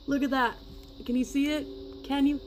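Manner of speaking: high, loud, excited showing-off; eager excitement. Real, not acted. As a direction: urge viewers loudly to look. Eagerly showing viewers the result.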